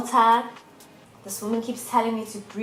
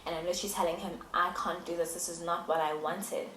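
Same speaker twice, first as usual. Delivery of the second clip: soft, low and defeated; quietly reliving the upset; subdued, resigned; 3 semitones lower, 9 dB quieter than usual. Real, not acted. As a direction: let the voice sink in sad acceptance. Recalls giving up and crying.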